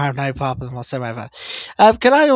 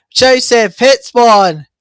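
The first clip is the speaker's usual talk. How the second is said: high, loud, stretched commentary shout; excited shot call; 8 semitones higher, 10 dB louder, much slower than usual. Real, not acted. Commentator calls Joseph's shot as it happens, excited.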